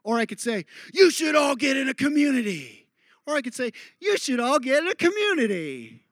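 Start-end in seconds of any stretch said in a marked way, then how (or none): 0.9-2.9 high, loud urging; big exhorting put-on voice; emphatic example. Shown as a demonstration. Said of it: "three very different meanings". 4.0-6.1 high-pitched put-on voice dropping at the end; exaggerated sing-out delivery; contrasting exaggerated example. Shown as a demonstration. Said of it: "three very different meanings".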